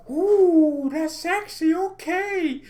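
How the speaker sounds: very high, swooning falsetto coo; mock admiring coo. A fan admiring the starting eleven in a high swooning coo. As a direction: swoon like you've seen something gorgeous.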